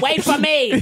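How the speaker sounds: whiny Mo plea. Retold or imitated. Friend acting out Mo chasing the train, whining and laughing. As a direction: beg the train to wait, laughing through it. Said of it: "acting out Mo at the train".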